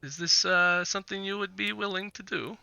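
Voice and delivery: lightly voiced Vistani trader, eager to make a deal; Vistani businessman. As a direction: play a businessman making his pitch, halting between phrases. Game master voices Vistani businessman.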